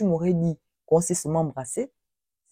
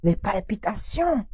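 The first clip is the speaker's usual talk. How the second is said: usual speaking voice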